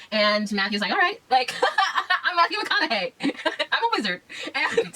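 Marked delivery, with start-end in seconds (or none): 0.9-4.2 laughing villain line. Retold or imitated. Villain answers, laughing through it.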